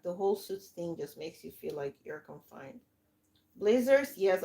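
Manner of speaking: usual speaking voice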